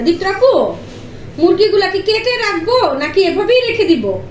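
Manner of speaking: loud, high call across the house. Real, not acted. Calling Dipto to ask about the chicken, loud and high. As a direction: shout for her across the rooms. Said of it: "আমি যে চিল্লায় চিল্লায় ডাকতেছি".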